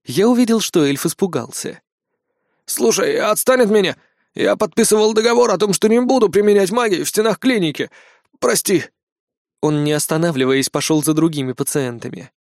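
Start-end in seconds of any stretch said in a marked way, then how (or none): 2.6-8.8 frightened elf. Acted.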